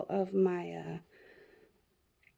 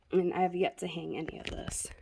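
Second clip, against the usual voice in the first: whispering; 3 semitones lower, 6 dB quieter than usual. Real, not acted. Aside about unhung decor.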